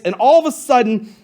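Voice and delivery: ordinary delivery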